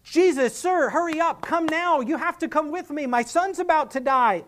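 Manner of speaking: a rich man used to giving commands, demanding; impatient master barking at a servant to hurry; commanding tone. Retold or imitated. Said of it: "being used to speaking in that tone".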